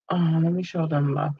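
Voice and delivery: ordinary delivery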